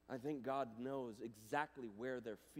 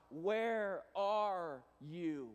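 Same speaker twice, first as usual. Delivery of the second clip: slow, drawn-out call; God calling out, long and slow; 7 semitones higher, 11 dB louder, much slower than usual. Acted. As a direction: call it out slowly across the garden.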